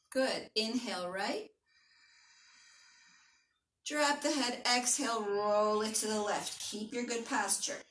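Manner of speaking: guided stretch. Acted. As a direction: ease them down into the stretch. Leads a slow stretch.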